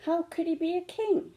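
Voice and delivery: put-on high, small child's voice full of wonder; a young cub asking her mother, puzzled; little cub voice. To her mother, a small cub's high questioning voice.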